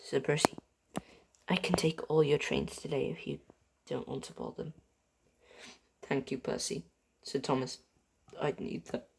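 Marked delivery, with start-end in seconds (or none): 1.5-9.1 hushed grief. Acted. Quiet grieving exchange.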